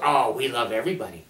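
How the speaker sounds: sugary mocking piety; mock gentle churchgoer. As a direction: parody their soft false niceness. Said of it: "you'll see their lips move like this".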